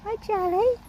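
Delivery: high, loud pet-talk hello; excited high coo; cooing greeting. Real, not acted. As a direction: squeal hi to a favourite horse. Greeting Charlie.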